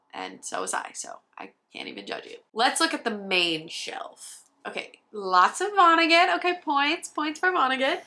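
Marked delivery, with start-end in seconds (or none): 0.1-2.4 muttered aside. Real, not acted. Low muttered self-admission. 4.7-8.0 excited. Real, not acted. Delighted at spotting Vonnegut.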